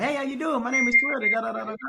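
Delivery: put-on high, loud, eager young caller; kid-like phone voice. Trainee imitating his own childish sales-call greeting. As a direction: sound like an overexcited kid on the phone. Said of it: "you sound like a kid".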